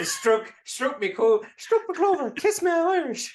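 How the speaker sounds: very high, loud, squeaky mock Irish leprechaun. Leprechaun fighting the fortune teller, mock Irish squeak. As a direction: ham up a cartoon Irish leprechaun. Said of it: "stereotypical banterisms".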